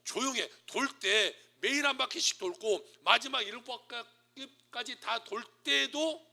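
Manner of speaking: firm, drawn-out loud command. Acted. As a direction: give orders as God to the people. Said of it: "이렇게 말한 거예요".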